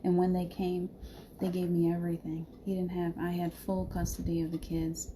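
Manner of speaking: ordinary talk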